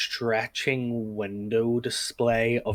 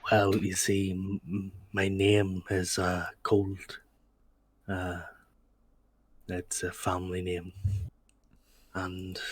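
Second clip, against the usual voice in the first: Northern Irish shopkeeper; 2 semitones lower, 3 dB quieter than usual. Acted. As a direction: take it gently and unhurriedly in a Northern Irish accent. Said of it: "it's a Northern Irish accent".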